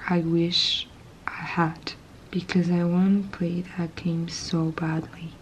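soft ASMR whisper; hushed unboxing whisper. Real, not acted. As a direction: breathe the words close to the mic.

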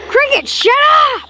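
high, slow, drawn-out yell; irritated yell. Acted. Telling a cricket to shut up, high yell. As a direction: yell it high, stretching the last word.

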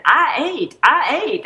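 "aisle eight" drawn into Southern "I ate", pitched high; high, swooping Southern "I ate"; Southern accent imitation. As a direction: mimic a Southern store clerk saying "I ate", the L dropped. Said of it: "secret Southern Dakota ring".

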